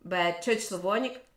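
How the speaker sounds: ordinary talk, nothing marked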